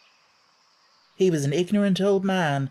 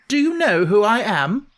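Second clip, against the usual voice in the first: put-on higher, louder haughty boy; demanding Rajah; 6 semitones higher, 6 dB louder than usual. Colin demanding of Ben, higher and haughty. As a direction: demand it like royalty. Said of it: "demanded the Rajah".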